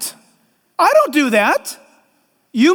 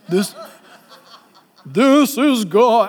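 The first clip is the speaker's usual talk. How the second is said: mock booming God voice; much slower than usual. Preacher mocking a TV God voice to his church, slow and booming. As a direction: announce it like a movie God. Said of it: "I don't think God talks like that".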